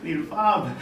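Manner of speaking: laughing through the words; giggling as you say it. Real, not acted. Joking quote while laughing.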